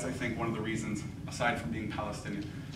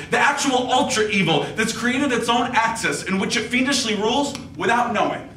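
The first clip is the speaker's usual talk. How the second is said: louder, higher slam poetry delivery, driven and rhythmic; spoken word poem; 11 semitones higher, 10 dB louder than usual. Acted. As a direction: declaim it like a slam poet on stage.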